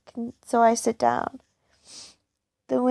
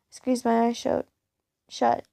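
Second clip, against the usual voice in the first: ordinary delivery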